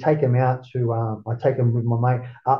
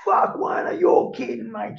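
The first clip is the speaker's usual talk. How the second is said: put-on excited kids; 11 semitones higher, slower than usual. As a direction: yell it like stunned kids seeing stars. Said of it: "the kids were just going".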